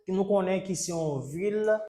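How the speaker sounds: normal talking voice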